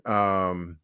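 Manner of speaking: normal talking voice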